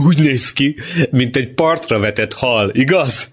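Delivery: high-pitched teasing voice; mocking. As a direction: make fun of him for an audience. Ábel mocks his brother before others. (gúnyolódva, magas hangon)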